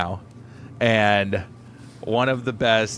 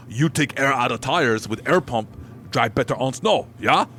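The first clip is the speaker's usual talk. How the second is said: put-on rough Russian accent, questioning at the end; bad Russian accent; 2 semitones higher than usual. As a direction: imitate a Russian with limited English. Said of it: "I don't have a good Russian accent either".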